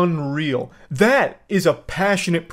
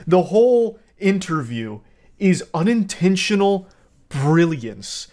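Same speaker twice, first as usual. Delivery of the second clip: ordinary delivery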